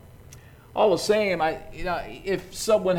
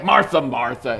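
louder, sharp "Martha, Martha" as a telling-off; scolding rebuke; 2 semitones lower, 6 dB louder than usual. Shown as a demonstration. Jesus the way he did not say it, scolding Martha. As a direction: say "Martha, Martha" like a stern reprimand. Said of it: "it's not like".

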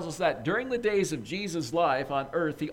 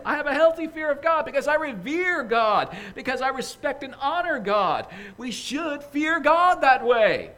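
higher, louder, insistent preaching; preaching at full force; 7 semitones higher, 6 dB louder than usual. Acted. Preacher urging the congregation to fear God, full force. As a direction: drive the point home from the pulpit.

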